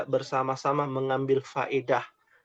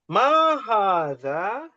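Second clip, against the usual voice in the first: slow, drawn-out question; slow model question; 5 semitones higher, 3 dB louder, much slower than usual. Shown as a demonstration. Teacher drills the Arabic question slowly. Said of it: "Nomor tiga".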